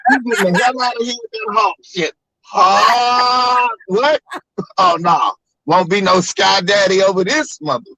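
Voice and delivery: high, put-on indignant dad voice; hammed-up angry dad. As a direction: chase them off in a mock rage. Voicing dad shooing missionary.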